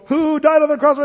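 lightly voiced teacher quizzing kids, high and loud; Sunday school teacher. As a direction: put the question brightly to little kids. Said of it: "when you're in Sunday school".

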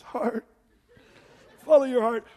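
high, loud mock quiver on "Heart". Shown as a demonstration. Preacher mocking the culture's slogan, hammed-up quiver. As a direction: tremble "Follow your heart" like a sappy slogan. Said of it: "say it with a quiver in your voice".

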